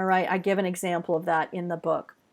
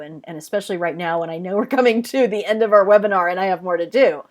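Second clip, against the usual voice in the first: hurried, fast and pushed; talking too fast; 5 dB louder, faster than usual. Real, not acted. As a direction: rush it like time is running out. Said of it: "sometimes we talk too fast".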